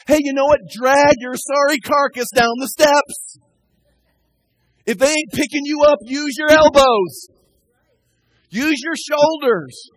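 loud, slow pulpit exhortation; shouting a rousing command from the pulpit; preaching at full force. Acted. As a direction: drive it home, word by word.

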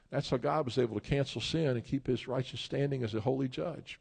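ordinary talk, nothing marked

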